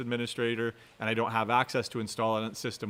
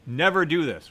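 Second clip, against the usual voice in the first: NEVER punched higher and louder, falling on this; stress on never; 3 semitones higher, 5 dB louder than usual. Real, not acted. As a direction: hit never hard as a warning. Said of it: "that's why I'm emphasizing it".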